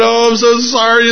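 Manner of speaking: high, flustered mock apology; mortified apology. Acted mortified preacher apologizing.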